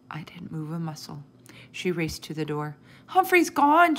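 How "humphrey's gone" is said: put-on high, loud child's wail. A narrator's reading. Sophie races to the door to tell, wailing. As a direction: wail it out in alarm. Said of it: "she wailed".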